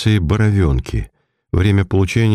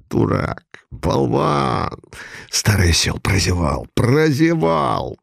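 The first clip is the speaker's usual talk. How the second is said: frenzied self-reproach; 2 semitones higher, slower than usual. A narrator's reading. Detective cursing himself for missing his man, frenzied but held in. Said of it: "как иступлённый, забегал по кабинету".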